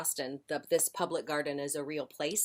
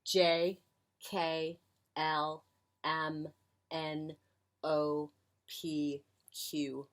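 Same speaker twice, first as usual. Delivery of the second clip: slow reciting; much slower than usual. Shown as a demonstration. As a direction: say each letter separately, drawn out. Demonstrates alphabet pattern in names.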